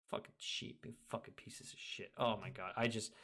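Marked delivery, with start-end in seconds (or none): 0.1-2.1 muttered curse. Real, not acted. Muttered insult aside.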